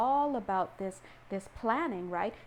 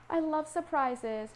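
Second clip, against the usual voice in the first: delighted; 6 semitones higher, slower than usual. Real, not acted. Delighted by the surprise card.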